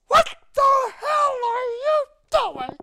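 screaming high and loud, slowly; furious shout. Retold or imitated. The colonel on the phone screams at the pilot, furious high shout. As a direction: blast him with a furious high-pitched yell. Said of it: "he was so mad".

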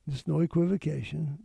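ordinary delivery

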